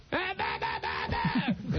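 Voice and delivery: low, sudden barking "bah" noises from a stranger; imitated Tourette's outburst. Blind man mimicking the woman his cane hit, barking "bah" tics. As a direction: bark out nonsense syllables like an involuntary tic. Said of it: "And she goes".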